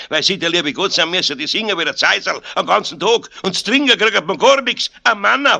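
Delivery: high, loud Munich rant, building; ungracious scolding. A narrator's reading. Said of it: "er war einmal im Schimpfen drin". (ungnädiges, lautes Schimpfen)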